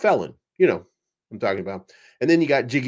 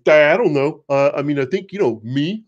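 mock evasive witness; 2 semitones higher, 5 dB louder than usual. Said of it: "I'm just making voices up as I go".

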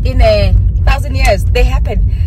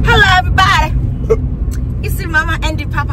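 higher, bright host greeting; vlog intro; 9 semitones higher, much faster than usual. Acted.

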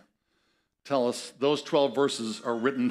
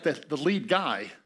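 ordinary talk

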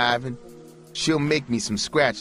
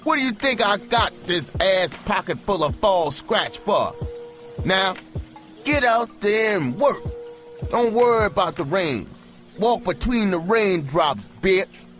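furious holler; 13 semitones higher than usual. A narrator's reading. Pimp hollers at his hooker through the window, furious. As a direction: tear into her with fury. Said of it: "he hollered".

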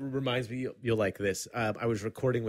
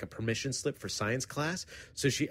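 ordinary talk, nothing marked

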